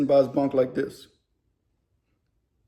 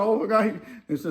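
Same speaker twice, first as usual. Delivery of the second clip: usual speaking voice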